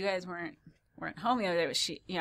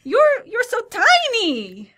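put-on very high, slow, incredulous Eastern European old lady; loud, drawn-out mock Eastern European accent; vague Eastern European accent; 14 semitones higher, 8 dB louder, much slower than usual. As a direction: ham up an astonished neighbour's Eastern European accent. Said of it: "still don't know what kind of accent".

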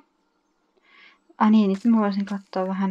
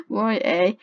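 ordinary delivery

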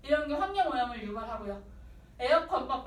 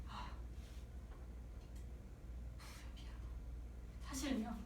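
guilty whisper; 3 semitones lower, 17 dB quieter than usual. Retold or imitated. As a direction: confess under your breath. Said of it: "이렇게 얘기를 하겠지".

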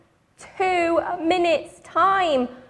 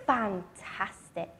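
ordinary delivery, nothing marked

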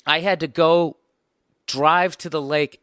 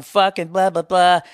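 loud, raised mocking of the heckler; 4 semitones higher, 5 dB louder than usual. Comic mimics the diner heckler, loud and mocking. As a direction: sneer it back like the jerk did.

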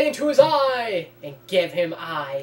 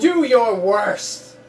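slow taunting hero; 7 dB louder, much slower than usual. Acted. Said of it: "I just love doing voicing".

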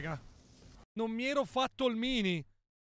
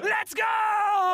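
high, drawn-out victory shout; goal celebration; 7 semitones higher, 3 dB louder than usual. Real, not acted. Esulta per il gol. (urlo di esultanza)

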